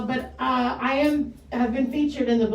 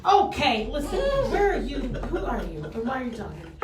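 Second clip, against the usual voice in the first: Southern character. Acted. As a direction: play a sassy Southern character, laughing a little. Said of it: "can't be a character that way".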